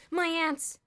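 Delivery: sudden loud high cry of alarm; cried out. A narrator's reading. Elmer finding his ants gone, crying out loud. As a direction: blurt it out in shock. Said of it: "he cried suddenly".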